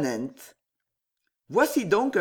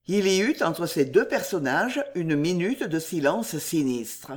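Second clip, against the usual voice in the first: ordinary delivery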